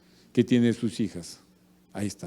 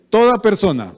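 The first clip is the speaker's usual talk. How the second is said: 1 frase para repetir; 5 semitones higher, 9 dB louder than usual. Shown as a demonstration. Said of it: "repitan conmigo".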